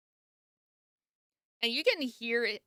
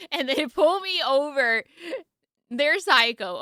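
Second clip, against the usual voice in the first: laughing; 7 semitones higher, 8 dB louder than usual. Real, not acted. Laughing about being pulled over.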